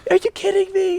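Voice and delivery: retold, jumping very high in pitch; as if you can't believe what you just heard; incredulous disbelief. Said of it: "And I was like".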